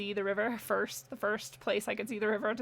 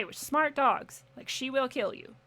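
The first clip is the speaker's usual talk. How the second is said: ordinary delivery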